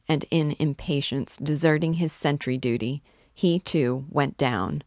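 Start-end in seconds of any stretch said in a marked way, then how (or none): none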